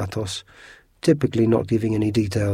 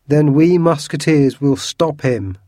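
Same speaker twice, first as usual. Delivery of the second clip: higher, louder and slow; crowing; 6 semitones higher, 8 dB louder, slower than usual. A narrator's reading. Porthos crowing he'll stop the Cardinal, loud and high. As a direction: crow it out in triumph. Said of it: "crowed Porthos".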